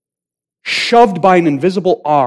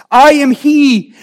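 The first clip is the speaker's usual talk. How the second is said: higher, louder, slow and ringing; triumphant proclamation; 10 semitones higher, 6 dB louder, slower than usual. Acted. Preacher proclaiming Jesus' surrender, loud, high and slow. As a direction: declare it with full force.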